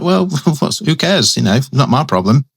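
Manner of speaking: high, careless who-cares voice; dismissive shrug. Retold or imitated. Reviewer imitating uncaring early Spider-Man, mock dismissive. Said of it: "So he's just like".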